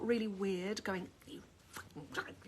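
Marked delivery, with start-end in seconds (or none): 1.3-2.3 a harsh whispered curse at the jar; hushed hateful whisper; hissed mock insult. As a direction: spit it out under your breath. Swearing at the hate jar.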